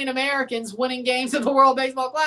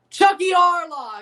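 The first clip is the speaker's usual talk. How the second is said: slow, loud drawn-out call of the name; 6 semitones higher, 5 dB louder than usual. Acted. Streamer calls out a name, slow and loud. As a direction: shout the name like an announcer.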